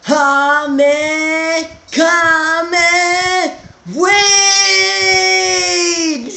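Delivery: big anime attack yell; rising battle cry; Kamehameha shout. Pretend. Kamehameha shout, Dragon Ball.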